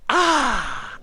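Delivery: high, drawn-out "ah"; exaggerated satisfied sigh after a sip; anime girl sigh. Player mocking his character's drink, anime girl sigh. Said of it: "like an anime girl and go".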